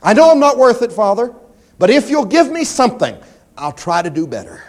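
high, loud, pleading whine; mock begging prayer. Preacher mocks a grovelling prayer, high pleading whine. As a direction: parody a grovelling believer begging God.

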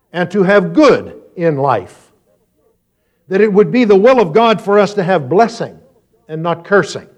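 high, emphatic pulpit delivery; preaching. Real, not acted. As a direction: press each question on the congregation. Preaches questions at congregation.

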